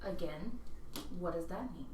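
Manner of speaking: soft, hushed voice; stage whisper. Real, not acted. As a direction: keep it low so nobody overhears. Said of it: "stage whisper so only the four of us can hear".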